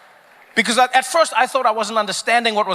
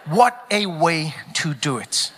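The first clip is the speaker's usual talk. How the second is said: low and drawn out, word by word; slow, savoured repeat; 5 semitones lower, slower than usual. Real, not acted. Comedian repeating the line to the crowd for effect, slowly and deliberately. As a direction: let each word land slowly.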